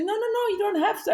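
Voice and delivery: imitating the woman waving off the offer; quick polite refusal. Quoting the soldier's wife declining, rapid and high.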